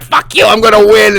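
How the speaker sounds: high, loud mock of a determined rival; defiant outburst. Host quoting a campaigning nominee, in a defiant outburst.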